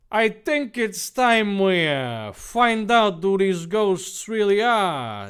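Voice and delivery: slow dramatic Dave. Acted. Narrator voicing Dave to the team, slow and dramatic. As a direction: drag it out like a cheesy hero.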